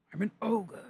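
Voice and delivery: whispery, voiceless ogre line; ogre impression. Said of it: "Say that in a Scottish accent".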